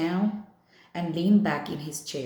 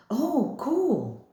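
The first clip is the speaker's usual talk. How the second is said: bright, high burst of enthusiasm; overexcited; 8 semitones higher than usual. Acted. Summer trying to get Augie psyched, overexcited. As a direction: sound too thrilled to cheer him up. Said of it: "maybe a little overexcited".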